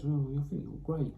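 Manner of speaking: high, adoring and a bit soft; fawning little despoiler voice. Retold or imitated. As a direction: gush like a starstruck fan. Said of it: "the despoiler looks up to him and just goes".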